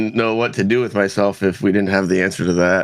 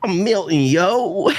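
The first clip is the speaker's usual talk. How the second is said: character voice; 8 semitones higher, slower than usual. Acted. Introduces his character.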